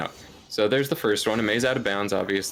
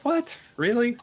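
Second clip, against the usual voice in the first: surprised; 13 semitones higher than usual. Retold or imitated. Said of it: "I was like".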